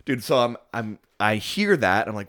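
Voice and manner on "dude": high loud bro voice; frat bro. Pretend.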